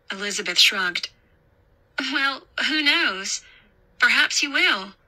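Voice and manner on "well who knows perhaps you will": a higher, lighter character voice; higher pitch, with a shrug in it; lightly voiced young woman. A narrator's reading. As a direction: toss it off lightly, a little higher. Elizabeth answers the woman lightly.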